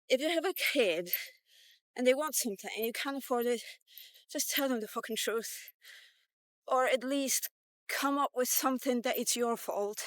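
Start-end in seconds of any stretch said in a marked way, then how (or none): none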